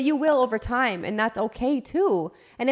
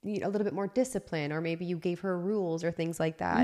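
soft, low, careful suggestion; gentle, not mean; 3 semitones lower, 9 dB quieter than usual. Retold or imitated. Partner carefully raising discipline with a parent, gentle. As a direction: tread lightly, retelling a tactful question. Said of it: "I didn't say them in a mean way".

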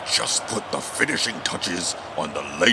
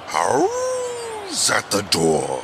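grand poem title; much slower than usual. Acted. Poet announces his poem's title.

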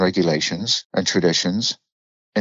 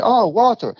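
put-on high, louder Indian-accented voice; 13 semitones higher, 3 dB louder than usual. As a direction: imitate an Indian shop clerk, pitched up. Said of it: "in Indian accent as well".

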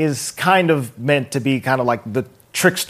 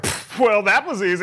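higher, scoffing villain voice; smug villain; 6 semitones higher than usual. Retold or imitated. Retelling Beck dropping the act, in a higher scoffing villain voice. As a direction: sneer it like the mask just came off. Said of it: "and he's like".

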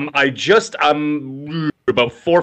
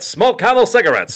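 chipper 1950s ad; 6 semitones higher than usual. Retold or imitated. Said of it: "cigarette commercials".

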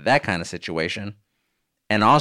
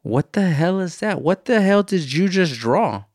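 put-on higher, slow childish outrage; imitating son; 8 semitones higher, 3 dB louder than usual. Son scolds mom's elf drawing, childish outrage. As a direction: play the blunt little boy judging a drawing. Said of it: "and he goes".

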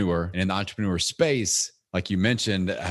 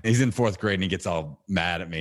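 normal talking voice

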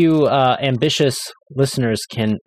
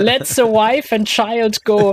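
slow, much higher hammed-up German accent; mock German accent; 9 semitones higher, slower than usual. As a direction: ham up a German accent for laughs. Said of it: "if you read that with a German accent".

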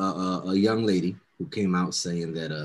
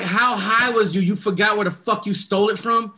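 incredulous yell; 11 semitones higher, 5 dB louder than usual. Retold or imitated. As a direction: scold the thief in disbelief. Mocking the thief's confusion.

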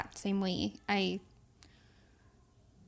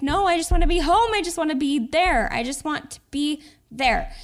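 higher, louder, pleading for home; urgent plea to just be home; longing; 5 semitones higher, 6 dB louder than usual. Retold or imitated. Mom retelling her wish to stay home, urgent and pleading. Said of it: "It was just like".

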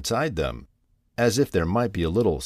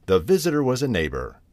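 plain reading voice

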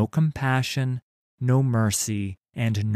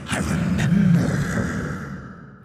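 slow, high rasping ghostly whisper; a sinister wraith breathing each word; cold hissing layered whisper; 10 semitones higher, 4 dB louder, slower than usual. Acted. Thresh's voice, the Wraith.

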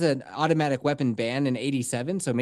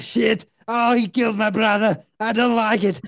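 rising higher and louder into a laughing panicked shout; a henchman losing his nerve, building to a yell; a goon spooked by a dead brother, building from worried to a shout; panicking henchman; 6 semitones higher, 5 dB louder than usual. Acted. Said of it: "the other ones are like".

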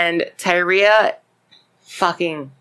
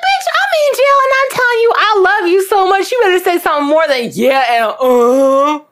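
very high, loud mock-outraged shriek; mad outburst; 13 semitones higher, 5 dB louder than usual. Host imagining herself in jail rebuking her partner, mad outburst. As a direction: screech it fast in exasperation, hammed up. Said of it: "That made me mad".

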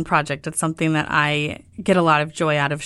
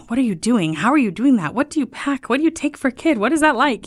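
higher, eager questioning voice of curious people; curious people asking; 7 semitones higher than usual. Retold or imitated. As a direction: imitate nosy friends firing questions. People peppering her with questions.

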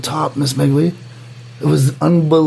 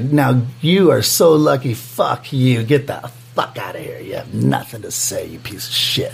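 lower, softer, slow contempt; judge snarl; 4 semitones lower, 3 dB quieter than usual. Retold or imitated. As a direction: growl it low and quiet like a scornful judge. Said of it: "looked at me like".

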